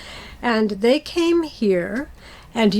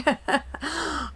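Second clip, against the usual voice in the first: soft laugh; 5 semitones higher, 6 dB quieter than usual. Real, not acted. Laughing at own mistake.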